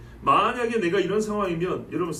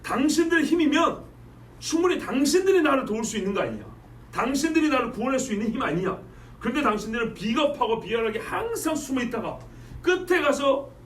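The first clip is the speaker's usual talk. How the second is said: raised, higher-pitched accusing retort; forceful rebuke; 5 semitones higher than usual. Acted. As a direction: lash out at cowardly allies, pressing hard. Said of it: "조금 더 힘을 줘 말했어요". (힘주어 쏘아붙이는 말투)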